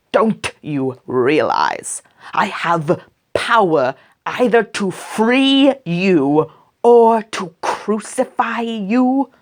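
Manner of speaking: slow, rising pressing question; a lightly voiced governor demanding an answer; Pilate threatening. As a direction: Pilate pressing Jesus to speak.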